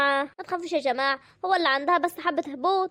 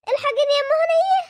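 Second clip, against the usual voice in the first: panicked cry for help; 8 semitones higher than usual. Acted. Woman panics over fainted girl.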